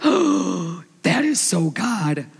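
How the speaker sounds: slow, drawn-out gasp of wonder; acted slow amazement; awed reply. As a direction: marvel slowly after a gasp. Gushing reply to friend's compliment.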